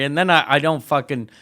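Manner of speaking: ordinary talk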